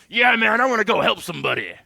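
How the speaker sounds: loud, pumped-up burst, then rushed; aggressive helper attitude. Acted. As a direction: act like you're raring to jump in. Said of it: "who has the attitude of".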